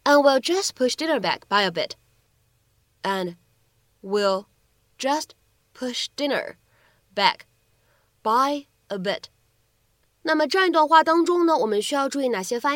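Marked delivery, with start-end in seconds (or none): 3.0-9.2 slow dictation with long pauses between chunks; word by word. Shown as a demonstration. Teacher breaks the sentence down for learners, word by word. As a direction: break it into single words and short chunks, pausing after each.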